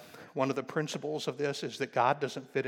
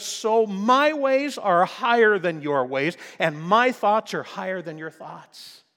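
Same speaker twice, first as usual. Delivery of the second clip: voice of God; 3 semitones higher, 8 dB louder than usual. Acted. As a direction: declare it with divine weight. God says through the prophet.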